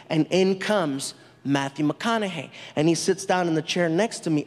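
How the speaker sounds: normal talking voice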